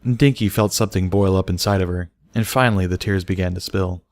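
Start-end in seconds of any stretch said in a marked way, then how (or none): none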